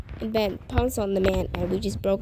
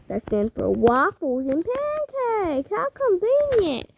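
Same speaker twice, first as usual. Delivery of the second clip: sarcastic; 9 semitones higher, slower than usual. Acted. Sarcastic aside on song name.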